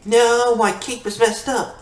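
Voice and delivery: real alarm at a broken cape; dismayed cry. Real, not acted.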